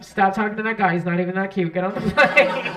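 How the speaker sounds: loud, bossy scolding over the intercom; brash New York announcer. Acted. New York lady scolds a dawdling passenger, brash loud holler. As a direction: order her aboard at full volume. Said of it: "this lady from New York gets on the intercom".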